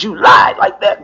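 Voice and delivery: accusing. Retold or imitated. Confronting the probation agent.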